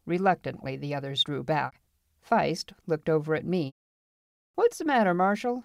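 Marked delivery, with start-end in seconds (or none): 4.5-5.6 high-pitched, needling jeer; taunting. A narrator's reading. Feist taunts the marshal, needling. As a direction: goad him in front of everyone. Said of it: "he taunted".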